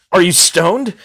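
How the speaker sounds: loud, high mock shout; mock angry. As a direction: imitate an angry man's accusing question. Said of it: "he got really angry at me".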